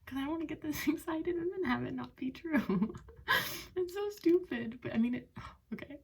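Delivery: high, giggly, nervous; nervous laughter. Real, not acted. About to see the test, high giggly nerves.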